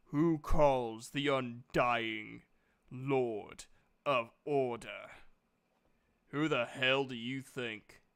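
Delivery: the summoned god demands who called him, slow and booming. Acted. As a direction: boom it out like an ancient knight spirit.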